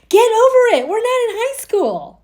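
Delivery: high, loud, scornful get over it; dismissive snap. Retold or imitated. Carrie dismissing Miranda on the phone, high scornful snap. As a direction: brush your friend off with contempt. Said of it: "Carrie goes on this".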